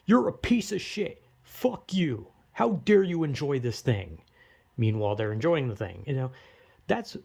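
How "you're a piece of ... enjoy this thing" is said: higher pitched sneering mock outburst; mock angry insult. Vlogger imitating a hypocrite's abuse as a mock angry outburst. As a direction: spit it out like a hypocrite troll.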